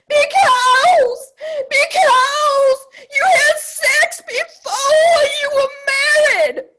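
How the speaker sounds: a hammed-up shrieking Southern mother; mother scolding her daughter over the furniture, loud mock outrage; mother's reply. Mother's stern reply voiced.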